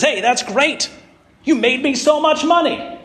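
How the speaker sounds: high, bright praise from the master; a master thrilled at the profit; delighted master. Retold or imitated. Master praising his servant, voice jumping up with delight.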